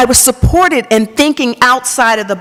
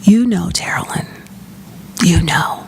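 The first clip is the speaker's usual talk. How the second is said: gentle whisper; 3 semitones lower, 4 dB quieter than usual. Retold or imitated. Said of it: "she very gently said".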